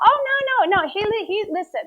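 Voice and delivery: high, hurried denial; flustered protest. Retold or imitated. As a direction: jump in to talk them down, stumbling. Achish protests the kings' suspicion.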